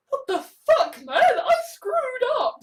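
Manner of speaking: high, laughing-crying self-reproach; half laugh, half sob at his own mistake; distraught retold quote. Voicing a man who killed his friend, crying. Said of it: "him kind of saying".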